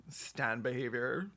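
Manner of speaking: ordinary talk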